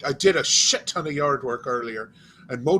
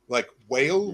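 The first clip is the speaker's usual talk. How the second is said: ordinary delivery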